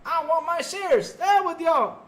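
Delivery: high, loud, slow smug exec voice; mocking Jim Ryan. Streamer mocking the retiring exec, high and loud. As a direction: play a greedy boss grabbing his shares. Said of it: "he went in there and said".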